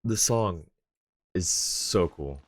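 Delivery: ordinary talk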